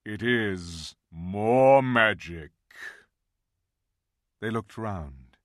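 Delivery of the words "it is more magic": slow, louder, grand voice of Aslan; great lion voice. Acted. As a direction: pronounce it slowly with majesty. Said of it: "said a great voice".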